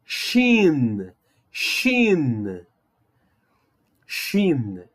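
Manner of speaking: Shin repeated slowly, each one falling; model the sound. Shown as a demonstration. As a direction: say shin three times, calm and clear. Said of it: "agora nós temos a letra shin".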